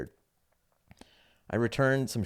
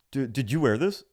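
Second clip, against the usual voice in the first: higher-pitched imitation of a doubting clerk; suspicious clerk; 5 semitones higher, 4 dB louder than usual. Clerk looks at the collar, mock suspicious. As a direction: mimic a clerk querying, voice lifted.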